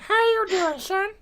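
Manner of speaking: high, soft creepy voice; hammed-up creep, bad accent; Herbert the pervert. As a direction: croon it softly, high and creepy. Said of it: "Herbert the pervert".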